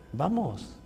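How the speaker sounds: very soft, unbothered agreement; quiet calm reply. Acted. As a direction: answer the dare calmly and softly. Meek brother accepts quietly.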